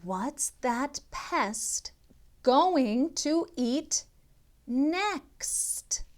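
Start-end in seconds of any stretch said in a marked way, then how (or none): none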